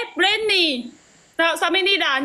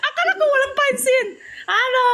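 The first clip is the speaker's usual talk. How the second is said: mock crying; 9 semitones higher than usual. Mock hurt at being ignored.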